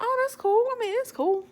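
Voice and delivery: high-pitched polite interest, humouring him; placating enthusiasm. Retold or imitated. Walker humouring an excited stranger, placating enthusiasm.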